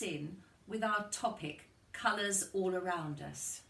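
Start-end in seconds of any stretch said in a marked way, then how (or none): none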